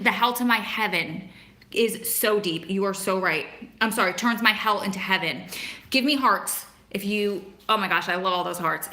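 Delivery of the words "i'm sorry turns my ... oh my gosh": ordinary talk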